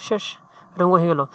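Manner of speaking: ordinary talk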